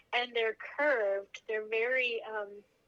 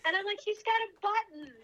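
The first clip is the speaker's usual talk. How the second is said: much higher, laughing delight; giggling; 9 semitones higher, 4 dB louder than usual. Real, not acted. Mother describes poking newborn's back, high giggling delight. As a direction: bubble over with giggles.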